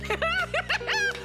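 high cackling laugh; gleeful laugh. Acted. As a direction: cackle with glee. Villain laughs at own joke.

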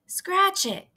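put-on high boyish yell. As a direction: toss it back cheekily. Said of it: "yelled Avery".

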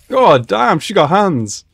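loud, high-pitched amazement; real excited reaction; impressed outburst. Real, not acted. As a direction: exclaim it, blown away by the fight. Reacting to a fight on screen.

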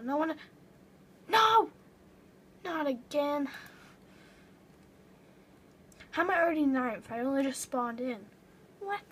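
loud, outraged gamer yell; frustrated shout. Real, not acted. Getting eaten, shouting.

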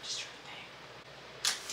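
whispered thought to herself; breathy whisper, slow. Real, not acted. Thinking aloud to herself.

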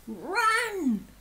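high, urgent storybook yell; panicked dad's narrated yell; yelled alarm. A narrator's reading. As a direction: call out high to your family to flee. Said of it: "Dad yelled".

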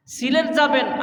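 ordinary talk

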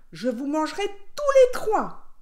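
wolf's threat rising to a loud, high peak; big storybook villain threat; menacing threat. A narrator's reading. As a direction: build to a shout on the last words. Wolf threatens all three pigs.

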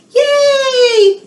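celebrate, loud and bright; excited cheer. Real, not acted. Librarian cheering the kids after the song, high and loud.

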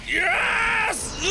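high-pitched shout of victory; triumphant. Real, not acted. Player finally gets the puzzle, triumphant. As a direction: cry out in relief at success.

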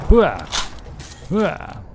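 loud army battle cry; loud gung-ho soldier. Acted. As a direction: bark it like a pumped-up soldier.

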